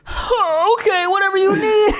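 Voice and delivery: high, squealing mock panic; hammed-up terrified appeasement; panicked caving. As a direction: cave in fast, voice leaping up. Threatened guy caving in panic.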